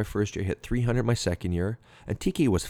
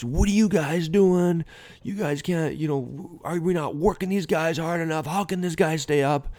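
high-pitched mock tirade, not full volume; retold angry yelling; 9 semitones higher than usual. Manager tearing into his coaches, retold angry yelling. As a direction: imitate a boss demanding answers in outrage. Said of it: "he started yelling at the coach".

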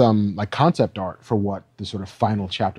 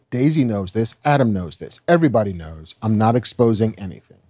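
ordinary delivery

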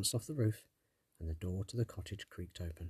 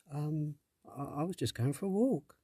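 put-on lightly higher, hesitant girl; young girl voice; 7 semitones higher than usual. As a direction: caught off guard, stammering. Startled girl answers sprite.

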